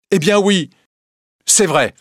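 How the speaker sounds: high, loud defiant cry; defiant confession. Acted. Wife confessing to her husband, loud and defiant. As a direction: throw the truth back at him.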